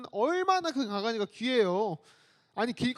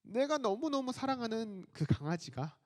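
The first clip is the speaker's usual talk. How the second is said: ordinary talk, nothing marked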